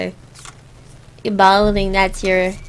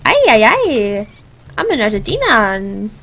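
mock Argentinian accent; 2 semitones lower than usual. Streamer imitating Argentinians for viewers, hammed-up Argentinian accent. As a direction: ham up an Argentinian accent, stretching the cries. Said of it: "Argentinians are very like".

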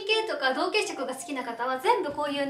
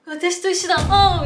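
mock surprise; 4 semitones higher, 7 dB louder, slower than usual. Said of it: "みたいに".

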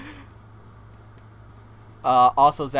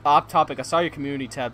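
ordinary talk, nothing marked

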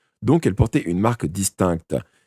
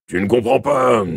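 raised, louder plaintive protest; helpless complaint; 5 semitones higher, 6 dB louder than usual. A narrator's reading. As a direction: policeman complaining at a loss. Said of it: "se plaignit le policier d'un ton désemparé".

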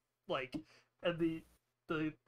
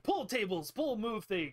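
higher, louder acted command; urgent ordering; 5 semitones higher, 5 dB louder than usual. Argus directing the captain to move tables, urgent ordering. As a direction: call out orders to the room.

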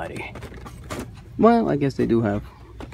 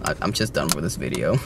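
lower, mock-weary hater voice; bored hater; 11 semitones lower, 5 dB quieter than usual. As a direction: play the annoyed Pokemon hater, lightly. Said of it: "those of you who hate Pokemon are like".

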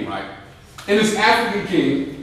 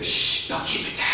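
hushing someone. Retold or imitated. Someone shushing noisy veterans, hushed. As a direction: shush them in an urgent whisper.

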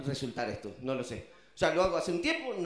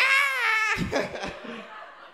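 burst of laughter; 3 dB louder than usual. Real, not acted. Laughing at own bit.

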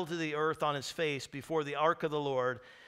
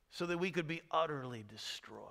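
whisper; 7 dB quieter than usual. Real, not acted. Ironic aside after the lament.

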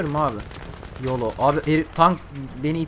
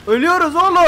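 high, loud cry to a teammate; alarmed shout; 12 semitones higher, 7 dB louder than usual. Real, not acted. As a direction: yell that you're both dying. Under attack, calls to teammate. (telaşla, tiz sesle bağırarak)

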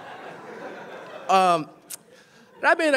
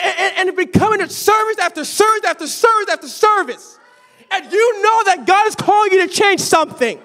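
higher, louder fiery sermon; preaching at full force; 10 semitones higher, 7 dB louder than usual. Acted. Preacher challenging the congregation to change, fiery preaching. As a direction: thunder the challenge at the church.

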